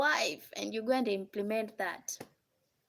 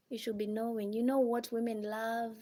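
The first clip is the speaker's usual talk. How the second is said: normal talking voice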